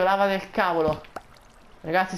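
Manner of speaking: ordinary delivery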